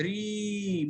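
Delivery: sustained, level ee on ري; long ya vowel. Shown as a demonstration. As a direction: stretch the ee in ري. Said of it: "مد بالياء".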